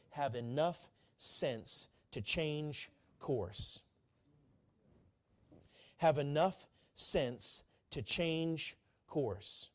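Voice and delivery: each word laid down slowly; slow emphatic repeat. Shown as a demonstration. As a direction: spell it out slowly for the congregation. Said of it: "Would you say that with me?".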